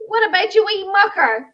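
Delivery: high, loud Northern Irish greeting; hearty mock Northern Irish accent; Aidan. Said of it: "he calls me".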